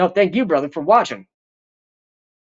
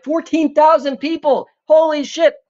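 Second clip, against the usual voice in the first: amazed; 9 semitones higher than usual. Real, not acted. Viewer count jumps.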